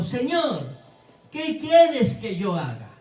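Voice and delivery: a loud, slow plea, shaken and fearful; trembling and fearful. Retold or imitated. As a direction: cry out to the Lord, humbled, in fear. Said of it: "temblando y temeroso". (Pablo, temblando, clama al Señor, fuerte y lento)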